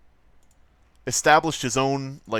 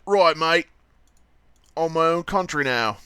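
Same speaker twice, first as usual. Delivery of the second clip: a light mock Australian accent, raised a bit; 6 semitones higher, 4 dB louder than usual. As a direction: toss it off like a cheeky Aussie bloke, lightly done. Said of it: "that's how Australians talk".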